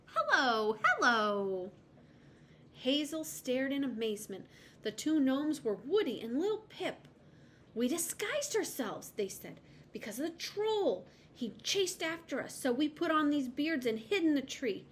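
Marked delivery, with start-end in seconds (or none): 0.1-1.7 loud cheery shout. A narrator's reading. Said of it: "whipped off their beards and shouted". 7.7-14.9 put-on high, boyish voices; little boys explaining their disguise; acorn boys. A narrator's reading.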